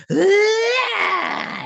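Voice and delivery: very high, loud shriek; imitated scream. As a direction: act out a piercing power scream. Imitating her scream as she absorbs stuff.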